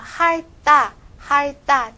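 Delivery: 할大 said high, hal-tta with tense tt; tensed 다. Shown as a demonstration. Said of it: "加这个다变成重音了".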